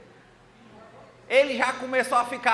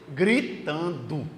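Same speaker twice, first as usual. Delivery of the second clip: slow, drawn-out word; 4 semitones lower, much slower than usual. Acted. King's head screaming inside.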